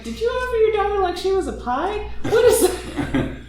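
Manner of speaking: very high, squeaky incredulous protest; laughing mock outrage. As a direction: demand an answer while cracking up.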